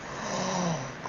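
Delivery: a sharp, hushed mock gasp of amazement; a theatrical fake gasp; mock gasp of awe. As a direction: gasp as if stunned by the news.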